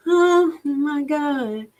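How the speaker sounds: high, drawn-out, slow oh my God; stunned gasp. Real, not acted. Vlogger stunned by the budget numbers, slow high gasp. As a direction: let the shock stretch the words out. Shocked reading the city budget.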